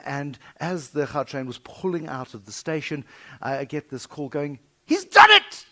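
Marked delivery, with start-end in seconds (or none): 4.9-5.7 very high, loud excited shriek; mad shouting. Retold or imitated. Caller on the train platform, a high loud shriek. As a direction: scream the news down the phone. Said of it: "this mad woman shouting it in my ear".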